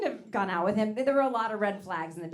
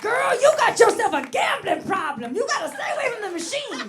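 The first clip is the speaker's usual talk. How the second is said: scolding neighbour lady; 13 semitones higher, 13 dB louder than usual. Retold or imitated. Neighbour lady telling the comedian she has a gambling problem. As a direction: warn her off the machines.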